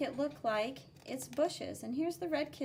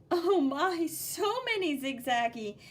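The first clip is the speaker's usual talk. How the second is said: loud, higher oh my; amazed; 4 semitones higher, 6 dB louder than usual. Acted. Teacher marvelling at the zigzags, loud and amazed. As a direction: gasp out oh my in wonder.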